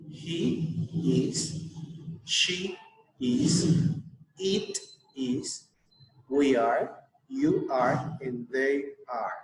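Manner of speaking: each pronoun and verb set apart, spoken slowly for the class; slow drill model. Shown as a demonstration. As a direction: model the drill clearly, word by word, to be repeated. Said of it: "you have to repeat after me". (profesora modelando la conjugación para que repitan)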